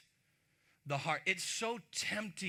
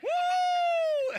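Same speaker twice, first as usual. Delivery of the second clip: whoop; 10 dB louder than usual. Real, not acted. Laughing off the parent quote.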